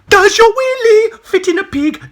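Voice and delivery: very high, loud, slow taunt; shrieked taunt. Pretend.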